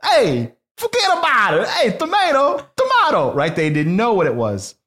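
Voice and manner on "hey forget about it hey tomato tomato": high, loud hammed-up Italian-American accent; mock Italian accent. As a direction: ham up a mobster-style Italian. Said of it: "I'm not doing the accent Jordan is".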